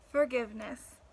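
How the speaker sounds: normal talking voice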